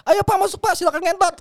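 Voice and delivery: put-on high, loud innocent boy; boy cheerfully inviting the kiai in; cheeky kid.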